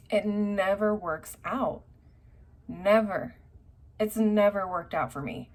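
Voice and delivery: slow, loud, pressing on never; emphatic never. Real, not acted. Reader ranting to viewers about exes, emphatic and slow. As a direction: lean hard on every never.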